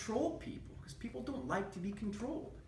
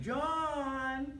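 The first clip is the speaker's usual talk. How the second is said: higher, louder call across the yard; 8 semitones higher, 3 dB louder than usual. Retold or imitated. Grandma calling her grandson in the yard, raised mock call. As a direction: call the boy's name from the back door.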